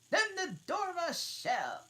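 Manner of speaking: high, loud eager shout; both demand it at once; Hare and Hatter crying out. Acted. Two mad hosts shouting their decision, high and loud.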